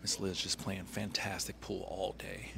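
slow hushed whisper. Real, not acted. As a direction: whisper it slowly under your breath. Quiet whisper during play.

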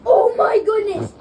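loud shocked shout, drawn out. Real, not acted. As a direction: blurt it out stunned. Gamer shocked in the game.

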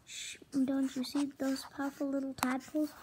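usual speaking voice

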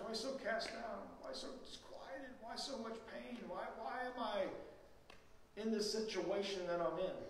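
David murmuring his despair under his breath; hushed lament. Acted. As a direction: breathe the questions quietly. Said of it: "he repeats that three times".

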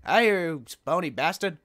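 loud mocking hello; cheeky loud taunt; taunting greeting. Real, not acted. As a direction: greet the enemy with a sneer. Taunting a skeleton.

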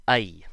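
I said loud, as uh plus E; North American I. Shown as a demonstration. Teacher loudly models North American I. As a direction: push out the uh-E I. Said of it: "mix of the short U sound".

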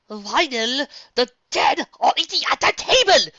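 frantic, high panicked yell; panicked shout. Acted. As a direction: scream it in horror. Panicked cry about skeleton.

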